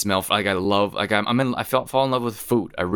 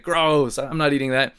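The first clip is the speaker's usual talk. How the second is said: higher, hammed-up grossed-out refusal; mock disgust; 7 semitones higher than usual. Little sister tasting the beet, higher mock-disgusted refusal. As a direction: spit it out like a kid who hates it.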